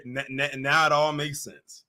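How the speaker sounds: ordinary delivery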